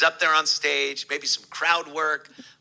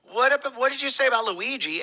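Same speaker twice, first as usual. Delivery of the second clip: higher-pitched, lightly voiced woman's question; imitated woman questioner; 9 semitones higher, faster than usual. Host retelling a woman's question to a comic, lightly higher voice. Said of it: "This woman asks".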